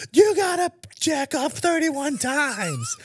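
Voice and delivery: high, slow hyped-up coach; hype coach voice. Pretend. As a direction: pump him up, slow and high. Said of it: "I would like him to be like".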